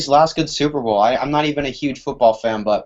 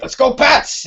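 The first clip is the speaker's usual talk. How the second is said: high, loud hyped-up fan chant; excited cheer; 14 semitones higher, 4 dB louder, slower than usual. Retold or imitated. Quoting hyped Boston fans.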